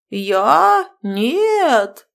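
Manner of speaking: high, loud protest; indignant. A narrator's reading. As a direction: deny it, offended. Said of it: "возмутился фамильяр".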